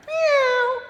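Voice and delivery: high, loud meow; cat meow. Acted. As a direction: mew like a cat. Cat joins story.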